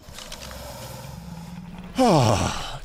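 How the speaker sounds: long, breathy exhale; heavy sigh after a struggle; exasperated sigh. Real, not acted. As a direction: let out a big frustrated sigh. Relief after getting unstuck.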